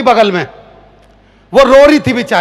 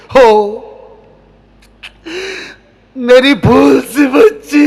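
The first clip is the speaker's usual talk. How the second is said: slow, choked-up mock sentiment; hammed-up tearful father's sigh; emotional father; much slower than usual. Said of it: "भावुक क्षण देखिए".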